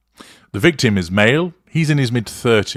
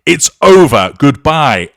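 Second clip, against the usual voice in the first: louder, higher, cutting him off; reenacted shout; 6 semitones higher, 9 dB louder than usual. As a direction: shout it like the angry mother.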